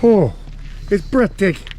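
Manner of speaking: high, breathless awe at the view; amazed. Real, not acted. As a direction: gasp it out in wonder.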